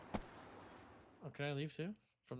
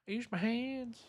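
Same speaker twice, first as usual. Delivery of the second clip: the line repeated much higher in a mock voice; 9 semitones higher, 3 dB quieter, slower than usual. Streamer repeating the line, mock high voice. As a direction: jump way up into a silly squeak.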